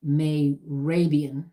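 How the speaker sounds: "Mehrabian" said very slowly, syllable by syllable as may-RAY-bee-an; careful name pronunciation. Shown as a demonstration. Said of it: "may have pronounced that wrong".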